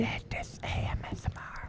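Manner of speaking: breathy close whisper; ASMR whisper. Pretend.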